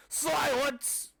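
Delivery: high angry shout; knight's furious shout. A narrator's reading. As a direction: cut him off hard. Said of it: "the knight shouts".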